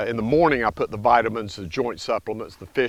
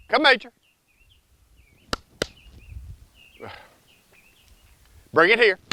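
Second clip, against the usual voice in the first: calling dog; 9 semitones higher, much faster than usual. Real, not acted.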